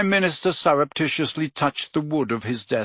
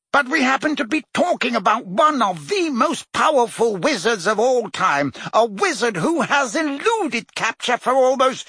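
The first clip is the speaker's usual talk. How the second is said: Fudge defending himself; 10 semitones higher than usual. A narrator's reading. Fudge defends his Aurors to the Prime Minister, insistent. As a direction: press each point, offended.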